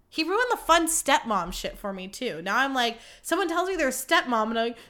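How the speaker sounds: ordinary talk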